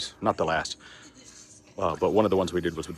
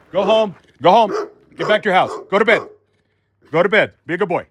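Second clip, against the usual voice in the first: loud, high, firm shout at the dog; shouting the pit bull off; firm commands; 13 semitones higher, 13 dB louder than usual. Real, not acted. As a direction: command the dog home with force. Ordering the dog away.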